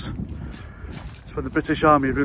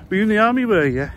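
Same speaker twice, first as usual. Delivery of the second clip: higher-pitched mock Scouse questioner; put-on Scouse accent; 5 semitones higher, 3 dB louder, faster than usual. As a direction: ask it nosily in Scouse. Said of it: "I'm putting a Scouse accent".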